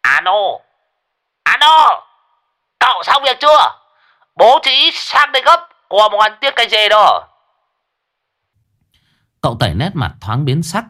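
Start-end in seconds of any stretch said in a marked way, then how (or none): 0.0-7.3 high, loud shrill phone call; old Đồng calling shrilly on the phone. A narrator's reading. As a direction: squeal it down the line, pitched way up. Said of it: "giọng tre chóe của lão Đồng".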